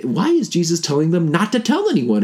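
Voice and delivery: ordinary talk, nothing marked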